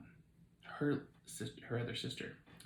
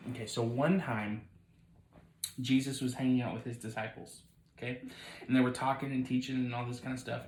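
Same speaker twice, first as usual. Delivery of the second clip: ordinary delivery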